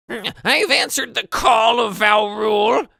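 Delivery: high, loud shouted goblin voice; high-pitched goblin hail, drawn out at first. Acted. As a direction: shout it as a goblin announcing himself. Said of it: "this goblin".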